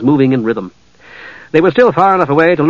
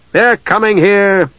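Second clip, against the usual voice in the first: higher, slow and heavy, grim certainty; 6 semitones higher, slower than usual. A narrator's reading. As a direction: lay down the bad news slowly. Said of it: "said the governor grimly".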